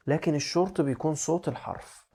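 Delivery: ordinary talk, nothing marked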